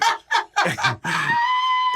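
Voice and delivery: very high screaming laugh; shrieking laugh. Real, not acted. Huge laugh.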